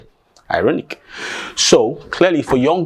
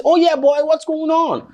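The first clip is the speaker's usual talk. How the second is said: excited calling. Acted. Calling across the farmland.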